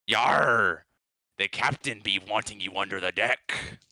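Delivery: put-on pirate talk with a loud opening; hammed-up pirate. Patches posing as an old sailor, hammed-up pirate voice. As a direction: play a badly disguised old sea dog.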